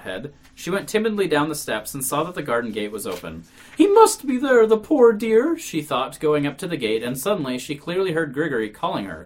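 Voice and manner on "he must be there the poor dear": put-on worried old woman. A narrator's reading. Marfa's worried thought, voiced.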